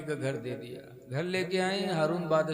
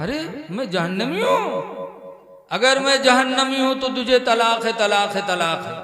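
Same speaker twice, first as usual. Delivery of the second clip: loud, high angry shout; furious outburst; 5 semitones higher, 7 dB louder than usual. Acted. Husband shouting divorce at his wife in fury. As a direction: hurl the divorce at her. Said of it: "तो हारून को गुस्सा आया".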